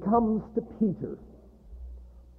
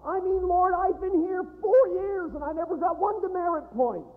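higher, aggrieved whine about never getting credit; resentful whine; 6 semitones higher than usual. Acted. Student to the Lord, aggrieved resentful whine. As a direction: complain like a wronged student. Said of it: "That's the never of resentment".

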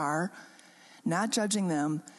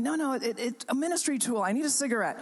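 urgent insisting; 6 semitones higher than usual. Retold or imitated. Insisting to congregants.

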